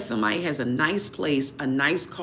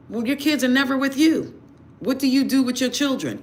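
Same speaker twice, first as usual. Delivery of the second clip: exasperated rebuke; 5 semitones higher than usual. Real, not acted.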